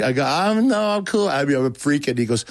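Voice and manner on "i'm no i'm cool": high-pitched mock brush-off; much higher voice, insisting all is fine; awkward polite refusal. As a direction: act out wriggling out of an invitation. Acts out brushing off the preacher.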